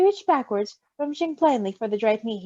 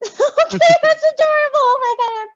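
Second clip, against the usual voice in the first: squealing delight; 8 dB louder than usual. Real, not acted. Squealing laugh over cuteness.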